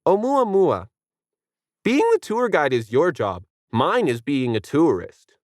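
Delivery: put-on smug kid. A narrator's reading. Kid answering the guide, smug. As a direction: answer sweetly and smugly. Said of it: "Then he smiled and said".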